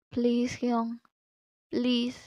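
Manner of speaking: hushed, gentle begging; a small, soft plea; soft pleading. Acted. Friend begging his hyung for a favour, soft pleading.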